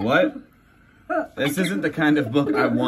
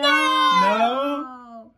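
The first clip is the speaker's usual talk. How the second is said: shocked no; 8 semitones higher than usual. Acted. Shocked there are more pages.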